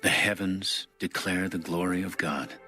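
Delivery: ordinary talk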